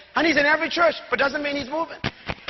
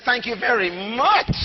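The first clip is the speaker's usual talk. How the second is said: slow, lower, gloating devil voice; smug devil; 4 semitones lower, slower than usual. Acted. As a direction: savour it like a pleased devil. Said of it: "the devil says".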